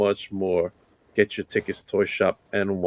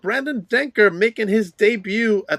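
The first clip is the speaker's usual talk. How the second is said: hype promo read; 8 semitones higher, 4 dB louder than usual. Acted. Hyped event promo read.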